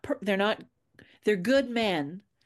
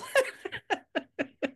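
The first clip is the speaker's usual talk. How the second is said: sudden high laugh; real laugh at her own dark joke; high burst of laughter; 12 semitones higher than usual. Real, not acted.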